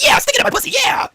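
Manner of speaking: hammed-up high shout; mock Stephanie shout. Stephanie eggs the men on, high shout. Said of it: "Batman said impression".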